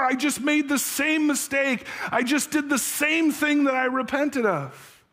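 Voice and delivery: husband crying out to his wife in anguish; tearful outcry. Acted. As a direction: wail the confession out in despair. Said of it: "he answered through his tears".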